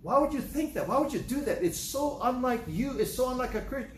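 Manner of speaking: high, exasperated scolding; indignant outburst, stretching the key words; angry disbelief. Acted. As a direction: plead in heated disbelief, pitch way up. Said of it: "he can get angry because".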